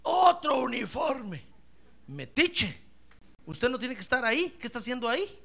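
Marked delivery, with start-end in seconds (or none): 0.0-1.5 mock complaint. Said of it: "hay quienes dicen".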